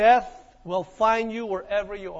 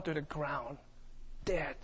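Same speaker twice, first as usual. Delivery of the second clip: hushed; 8 semitones lower, 14 dB quieter than usual. Real, not acted. Whispered end of shooting.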